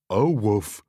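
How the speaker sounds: loud goofy oh woof; dog bark. Acted. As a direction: bark in protest. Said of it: "barks the dog".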